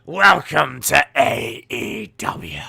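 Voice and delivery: loud, slow mock pirate announcer; pirate voice, stretched out; pirate talk. Shown as a demonstration. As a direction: bellow it in a hammed-up pirate accent. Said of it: "same as talking like a pirate".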